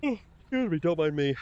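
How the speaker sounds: talking through a yawn. Real, not acted. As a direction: yawn while saying it. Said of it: "I'm yawning in your ear".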